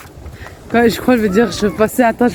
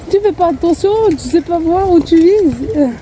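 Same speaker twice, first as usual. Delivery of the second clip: scolding; 7 semitones higher than usual. Retold or imitated. Scolding husband or son.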